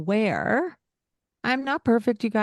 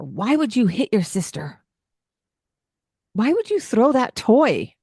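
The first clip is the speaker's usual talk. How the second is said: mock scolding parent; 4 semitones higher, 4 dB louder than usual.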